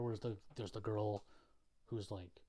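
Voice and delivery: ordinary delivery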